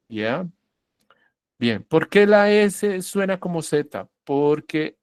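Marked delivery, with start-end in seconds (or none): none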